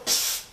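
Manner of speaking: sharp voiceless psst; secretive attention-getting hiss; imitated psst. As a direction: psst someone over from the next room. Imitates beckoning through a door.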